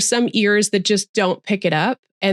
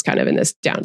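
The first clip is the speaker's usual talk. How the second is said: low creaky fry dropping off; vocal fry demo; faster than usual. Shown as a demonstration. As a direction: sink into a crackly vocal fry. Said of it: "end a lot of their sentences".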